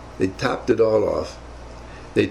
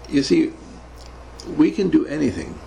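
ordinary talk

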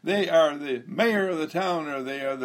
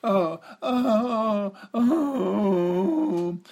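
drawn-out, higher dying moans; dying groans; 7 semitones higher, 3 dB louder, much slower than usual. Shown as a demonstration. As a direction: ham up a slow death. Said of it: "pretend that you're dying by slow bits".

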